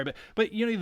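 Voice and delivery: normal talking voice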